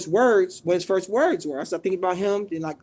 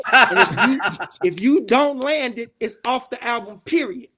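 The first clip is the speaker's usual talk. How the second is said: stern ultimatum; 3 semitones higher, 5 dB louder than usual. Retold or imitated. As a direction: lay down the law, imitating a boss. Acting out the boss's ultimatum.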